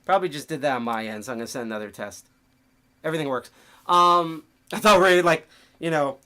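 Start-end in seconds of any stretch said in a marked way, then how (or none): none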